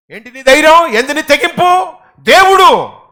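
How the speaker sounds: high, loud building to దేవుడు; preaching shout. Real, not acted. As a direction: cry out the answer triumphantly. (గట్టిగా అరిచి చెప్పడం)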